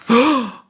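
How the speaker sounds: loud, high theatrical gasp; amazed gasp. Acted. Colleague dazzled by boss's maths, big amazed gasp. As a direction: gasp in mock wonder.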